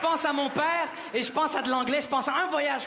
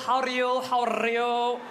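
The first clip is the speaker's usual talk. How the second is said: put-on slow, drawn-out accented English greeting; father's accented English; slower than usual. Father greets the approaching border officer, slow accented English. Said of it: "c'est mon père qui va parler en anglais".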